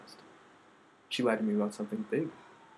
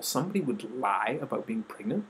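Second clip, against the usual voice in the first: ordinary delivery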